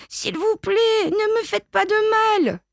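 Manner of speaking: put-on very high child's plea; pleading. A narrator's reading. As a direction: beg like a frightened little kid. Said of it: "supplie Petit Biquet".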